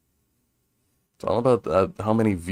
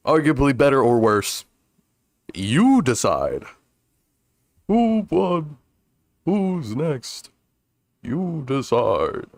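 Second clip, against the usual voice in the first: TV announcer; 4 semitones higher, slower than usual. Pretend. Mock TV-show announcer lines.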